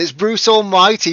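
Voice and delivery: high, loud excited announcement; high and loud, the correct name; excited correction. Real, not acted. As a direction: proudly announce the right winner. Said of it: "No, it's not Bruce Forsyth".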